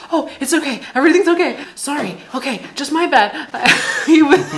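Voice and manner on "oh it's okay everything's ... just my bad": flustered apology. Retold or imitated. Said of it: "he was like".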